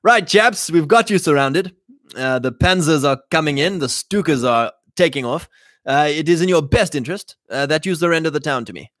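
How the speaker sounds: mock British officer ordering a town to yield. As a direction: play a British officer coolly demanding surrender. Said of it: "it's gonna be my British officer voice".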